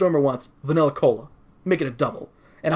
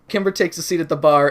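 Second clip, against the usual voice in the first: usual speaking voice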